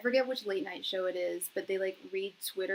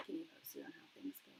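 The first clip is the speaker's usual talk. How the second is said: whispered aside; 5 semitones lower, 18 dB quieter, faster than usual. Real, not acted. Trailing off into whisper.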